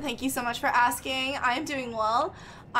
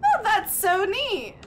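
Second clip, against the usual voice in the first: delighted; 8 semitones higher, 4 dB louder than usual. Real, not acted. Delighted reaction.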